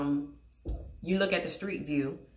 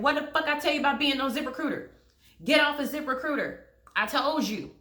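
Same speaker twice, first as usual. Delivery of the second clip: fast, loud, high-pitched scolding yell; angry scolding; 7 semitones higher, 3 dB louder than usual. Retold or imitated. Scolding her friend for using ZipRecruiter again, furious yell. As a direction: chew her out for ignoring your advice.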